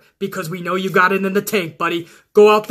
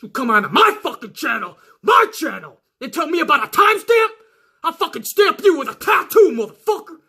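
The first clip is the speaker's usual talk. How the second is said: hammed-up rage at a troll; furious rant; 8 semitones higher than usual. Real, not acted. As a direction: go back on the attack. Ranting at commenter.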